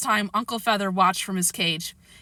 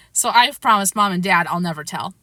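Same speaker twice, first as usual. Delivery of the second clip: ordinary delivery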